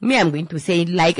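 ordinary talk, nothing marked

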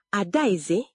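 ordinary delivery

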